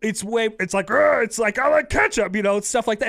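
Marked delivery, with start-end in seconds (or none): 0.9-1.2 high goofy grunt; higher cartoonish grunt; Mahomes parody. Shown as a demonstration. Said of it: "It's not even an impression". 1.6-2.3 high goofy cartoon voice; higher cartoonish voice; Mahomes parody. Shown as a demonstration. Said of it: "It's not even an impression".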